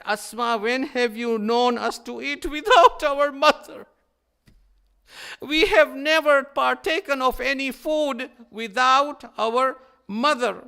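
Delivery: loud, high insistence, the last words slowed; pleading protest. Acted. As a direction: press Asma hard, refusing to eat alone.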